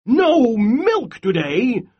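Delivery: high, loud, slow angry cry. Acted. Farmer crying out at his striking cows, loud and angry. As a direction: cry it out in disbelief. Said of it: "cried Farmer Brown".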